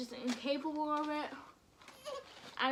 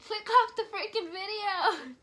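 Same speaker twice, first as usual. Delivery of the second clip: raised, higher and louder; exasperated; 6 semitones higher, 7 dB louder than usual. Real, not acted. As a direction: snap at the viewers. Warning viewers off the video.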